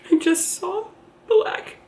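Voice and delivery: real tearful, high halting voice; slow, struggling to get it out through tears; choked up. Real, not acted. Tearful account of losing baby.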